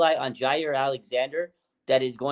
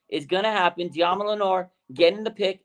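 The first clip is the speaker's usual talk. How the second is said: normal talking voice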